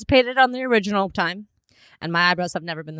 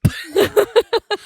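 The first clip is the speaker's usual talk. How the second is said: burst of laughter; 13 semitones higher, 4 dB louder than usual. Real, not acted. Shocked laughter.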